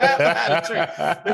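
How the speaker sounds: can barely get the line out for laughing; laughing quote. Retold or imitated. Laughing while retelling the tree-hiding scene. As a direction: say it through laughter, cracking up. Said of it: "That was the funniest thing".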